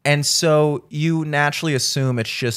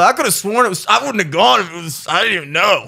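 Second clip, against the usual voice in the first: higher-pitched mock confusion; feigned innocence; 5 semitones higher, 4 dB louder than usual. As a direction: play clueless, covering a deliberate slip. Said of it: "acting like I fucked up".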